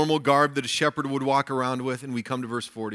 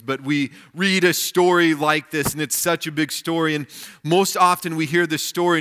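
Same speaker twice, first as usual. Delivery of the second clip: ordinary talk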